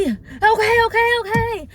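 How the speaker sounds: high, loud repeated okays; mock flustered driver. Driver finally agreeing to turn back, high mock fluster.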